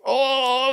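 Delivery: high mock gasp from the water; toddler's gasp. A toddler gasps after being pushed into the pool, in a high squeak. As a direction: squeak it like a startled kid. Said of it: "they're like".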